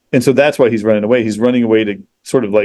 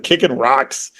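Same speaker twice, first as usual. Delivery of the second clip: ordinary talk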